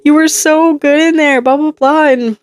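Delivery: high, gushing praise; cheering coworkers. Retold or imitated. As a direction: gush like a thrilled colleague. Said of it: "coworkers come out and they're like".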